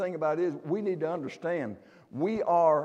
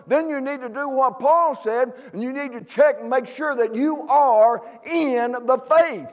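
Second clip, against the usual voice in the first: raised, ringing preacher voice, higher and louder; full-force preaching; 8 semitones higher, 9 dB louder than usual. Acted. Preacher urging the church to the house of the Lord, full force. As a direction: drive the call home to the congregation.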